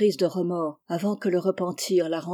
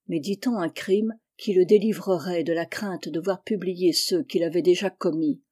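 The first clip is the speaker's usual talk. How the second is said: usual speaking voice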